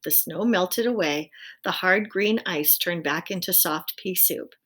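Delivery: plain reading voice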